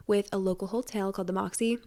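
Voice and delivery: slips into an odd accent on the Moxie; a stray, unplanned accent creeping into the Moxie line; accidental accent. Real, not acted. Podcaster plugging a hotel giveaway, drifting into an accent. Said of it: "I just had an accent".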